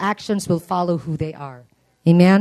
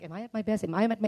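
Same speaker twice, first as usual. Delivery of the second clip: mock doubtful murmur; 2 semitones higher, 10 dB quieter, much faster than usual. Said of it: "some of you are like".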